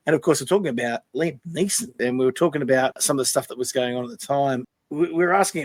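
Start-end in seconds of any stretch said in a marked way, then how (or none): none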